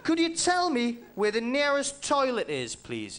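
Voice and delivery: lifted, overly polite asking voice; polite request. Acted. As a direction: ask sweetly like a lost tourist. Comic voices posh polite request.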